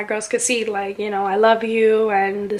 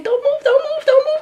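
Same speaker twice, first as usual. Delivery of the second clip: much higher squeaky mock doctor repeating; doctor urging. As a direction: imitate the doctor's panicky high-pitched warning. Doctor urging her to stay still.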